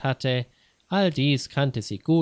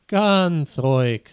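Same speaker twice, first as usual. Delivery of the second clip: slow, loud, firm calming order; courteous but commanding; polite but firm; 3 semitones higher, 6 dB louder, slower than usual. A narrator's reading. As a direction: stretch it out to take control, politely. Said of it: "sagte ein Polizist höflich, aber bestimmt".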